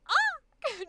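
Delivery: a sudden high, loud "Ah" of delight; excited exclamation. Shown as a demonstration. Coach showing her commentary, a high loud exclaim. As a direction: blurt it out like a thrilled viewer. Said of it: "tend to commentate".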